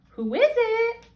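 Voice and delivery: put-on high old granny voice calling out. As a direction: call out from the bed as the old grandmother. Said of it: "called out Granny".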